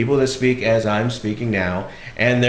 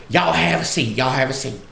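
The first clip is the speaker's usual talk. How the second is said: put-on Southern old lady; 4 semitones higher than usual. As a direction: offer a seat like a country hostess. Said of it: "sweet little old lady".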